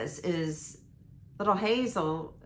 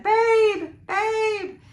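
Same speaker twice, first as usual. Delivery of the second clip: high, loud mimicked child's holler; imitating toddler calling; 9 dB louder than usual. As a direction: copy a little girl calling for dad. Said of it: "Isabella started calling for her dad".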